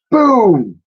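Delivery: loud high boom; big narrated boom; booming sound. A narrator's reading. Sound effect.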